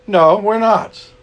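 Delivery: ordinary delivery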